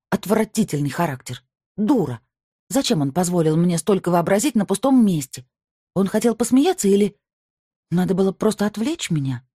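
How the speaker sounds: bitter scolding of herself; self-reproach. A narrator's reading. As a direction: berate yourself angrily. Inner self-reproach monologue.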